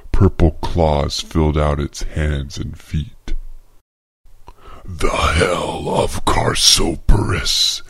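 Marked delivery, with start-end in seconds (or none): 4.9-7.8 slow, rigid, overpronounced monster rasp; deep wet voice. Acted. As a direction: wet creature voice, every word pronounced stiffly. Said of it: "in rigid and overly pronounced words with a deep, wet voice".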